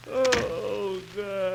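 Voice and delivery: words stretched through a yawn. Acted. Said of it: "Jack, stop yawning".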